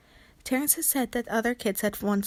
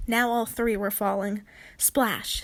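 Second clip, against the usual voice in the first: plain reading voice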